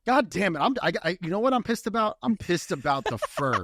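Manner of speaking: high, indignant rich lady rant; mock an entitled rich lady.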